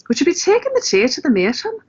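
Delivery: high Irish lilt going up at the ends; Irish accent. Shown as a demonstration. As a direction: put on an Irish accent, inflection rising. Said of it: "they go up on the ends".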